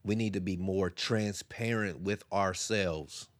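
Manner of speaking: usual speaking voice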